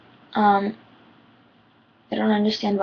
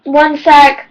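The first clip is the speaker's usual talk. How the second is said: loud, raised-pitch call; 6 semitones higher, 11 dB louder than usual. Real, not acted. Vlogger interrupted mid-recording, calling out loudly. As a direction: call out briefly to hold someone off.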